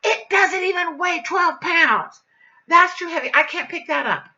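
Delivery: loud, high, indignant yell; exasperated, mocking shout; furious outburst. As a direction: shout it in outraged disbelief. Acting out her furious outburst.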